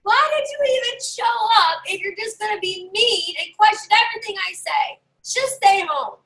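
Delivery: high, half-shouted mock rant; mock scream. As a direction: pretend to tell the heckler off. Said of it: "you're screaming that".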